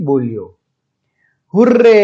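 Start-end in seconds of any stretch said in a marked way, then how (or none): 1.5-2.0 high, loud hurray; shouted cheer. Acted. Teacher reads the lucky mouse's shout to pupils, loud cheer. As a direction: call out a storybook cheer. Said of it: "shouted lucky mouse".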